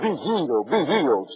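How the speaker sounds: quiet, breathy mock healer chant; mock faith healer. Preacher mocking faith healers, softly intoned. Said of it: "neck poppers".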